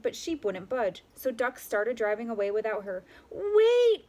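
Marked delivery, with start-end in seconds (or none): 3.3-4.1 high, loud desperate cry. A narrator's reading. Sheep cries out as the tractor leaves, loud and high. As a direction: yell for them to stop. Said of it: "cried Sheep".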